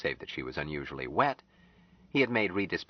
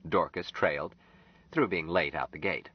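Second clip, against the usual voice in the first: normal talking voice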